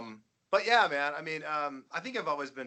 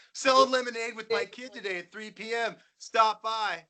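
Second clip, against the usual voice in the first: mock mundane rock star; 9 semitones higher than usual. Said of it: "they're just like".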